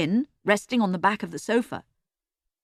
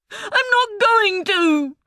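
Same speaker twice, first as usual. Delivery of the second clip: booming; 14 semitones higher, 5 dB louder, much slower than usual. A narrator's reading. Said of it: "Emily boomed".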